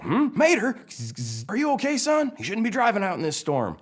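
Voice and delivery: high, loud, concerned lawman character; Sheriff. Acted. Sheriff checks on zapped Mater, loud concerned voice. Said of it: "He's all".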